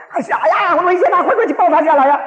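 high, loud frantic grandmother; mock panicked shouting. Mother-in-law yelling to get the climbing child down, mock panicked shout. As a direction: cry out in exaggerated alarm, imitating her. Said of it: "大喊起来".

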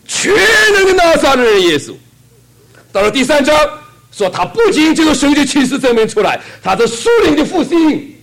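high, loud preacher's cry, breaking near the end; full-force preaching. Acted. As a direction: shout the revival to the congregation.

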